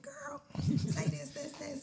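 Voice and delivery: put-on high, slow girly chatter voice; a viewer urging her on, in a high, slow girly voice; mock viewer voice. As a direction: squeal it like a pushy fan. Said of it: "They're like".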